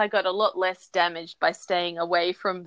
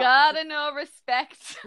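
mock tough guy; 6 semitones higher, slower than usual.

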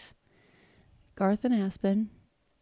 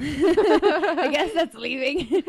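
laughing; 6 semitones higher, 10 dB louder, much slower than usual. Real, not acted. Laughs at own slip.

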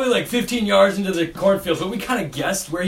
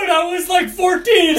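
high-pitched plea through laughter; embarrassed, laughing squeal; laughing protest; 11 semitones higher, 5 dB louder than usual. Real, not acted. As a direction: defend yourself while cracking up. Laughing defence of embarrassing story.

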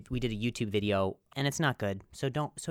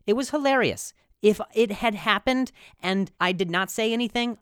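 excited; 8 semitones higher, 6 dB louder than usual. Real, not acted. Excited retelling.

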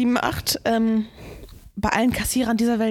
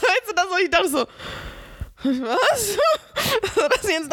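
high, laughing explanation; 10 semitones higher than usual. Real, not acted. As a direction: explain while laughing hard.

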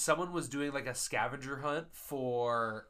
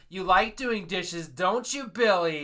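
slow, higher, louder teasing; mocking praise; 5 semitones higher, 6 dB louder, much slower than usual. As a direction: talk down to him like a pet. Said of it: "I was like".

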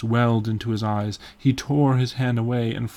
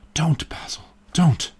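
high, urgent plea to stop; desperate high-pitched begging; crying out; 5 semitones higher, 4 dB louder than usual. A narrator's reading. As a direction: cry out in alarm, louder on the repeat. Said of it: "he cried".

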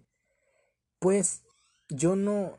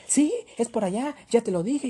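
imitated snapping yell, higher and louder; impatient yell; 7 semitones higher, 5 dB louder than usual. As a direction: mimic her yelling back impatiently. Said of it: "me empezó a gritonear". (imitando un grito impaciente, más agudo y fuerte)

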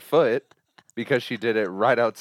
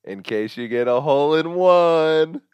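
embarrassing dad joke; 7 semitones higher, 7 dB louder than usual. Acted.

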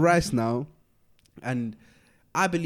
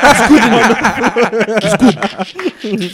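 drawn-out reassuring; 7 semitones higher, 13 dB louder, much slower than usual. Retold or imitated. Stretched reassurance to crowd.

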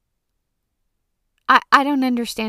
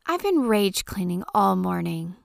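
usual speaking voice

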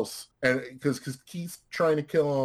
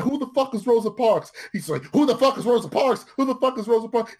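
clueless yelling dummy; 10 semitones higher than usual. Retold or imitated. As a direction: keep yelling the question like a total idiot. Strike acted, repeating question.

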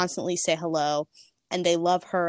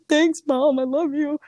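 high emotional thanks; 9 semitones higher, 5 dB louder than usual. Retold or imitated. Emotional reply to supportive mom.

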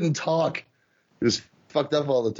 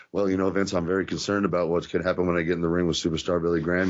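much lower, fast, earnest aw-shucks promo; lower, earnest wrestler promo impression; Bob Backlund impression; 6 semitones lower, faster than usual. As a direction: rattle it off like an eager clean-cut babyface. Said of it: "It would be stuff like this".

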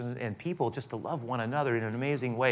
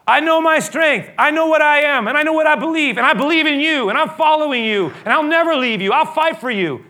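Peter shouting his loyalty, high and loud; bold loyal vow; 13 dB louder than usual. Acted. Preacher voicing Peter's vow to Jesus, high and loud. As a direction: proclaim it with supreme confidence.

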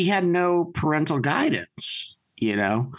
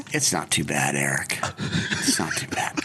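quiet reassurance; 12 semitones lower, 3 dB quieter than usual. Retold or imitated. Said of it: "he goes".

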